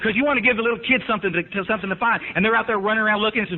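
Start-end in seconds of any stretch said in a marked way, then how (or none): none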